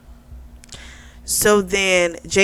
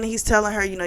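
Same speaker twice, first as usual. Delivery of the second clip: ordinary talk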